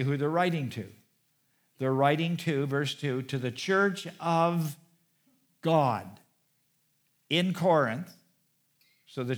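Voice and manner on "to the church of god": weight on "God", set off by a pause; slow down into "church of", pause, then land "God"; emphatic. Real, not acted. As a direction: stretch "church of" and drop onto "God". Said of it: "kind of emphasize it".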